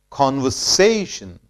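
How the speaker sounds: "Conversation" with a peak on SAY, then falling; stress on SAY. Shown as a demonstration. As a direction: model "Conversation" for repeating as con-ver-SAY-shun. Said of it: "more stress is laid on syllable say".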